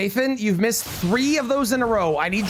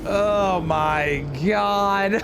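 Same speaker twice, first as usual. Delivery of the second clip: drawn-out disbelief; much slower than usual. Real, not acted. Disbelief after death.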